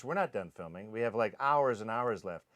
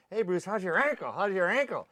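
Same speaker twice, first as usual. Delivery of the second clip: teasing coworkers; 5 semitones higher than usual. Retold or imitated. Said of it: "So they're going".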